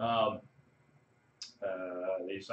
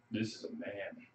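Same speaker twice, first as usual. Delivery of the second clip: Drax; 2 semitones lower, 9 dB quieter, slower than usual. Retold or imitated. Imitating Drax drooling over Thor, hushed awe. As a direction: murmur it in mock admiration. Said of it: "Drax is all drooling over him".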